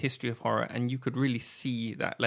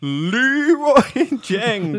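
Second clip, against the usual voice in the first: shouting; 9 semitones higher, 8 dB louder, much slower than usual. Pretend. Shouted meme name, stretched.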